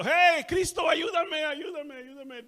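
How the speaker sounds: high, loud scream fading to a plea; screaming for help. Retold or imitated. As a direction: scream for Christ, then fade. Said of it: "grité a Cristo".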